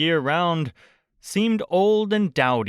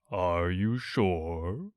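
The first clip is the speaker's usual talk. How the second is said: low, slow, mournful donkey voice; gloomy Eeyore; 6 semitones lower, 7 dB quieter, much slower than usual. A narrator's reading. Narrator voicing Eeyore to Pooh, low and glum. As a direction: drag it out like a sad old donkey.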